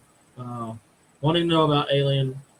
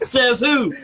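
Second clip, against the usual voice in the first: laughing; 7 semitones higher than usual. Real, not acted. Host laughing at joke.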